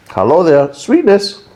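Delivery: sing-song high "Hello there, sweetness"; teasing high-pitched hello to the sweetness; mock greeting. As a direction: greet the flavour like a cheeky cartoon.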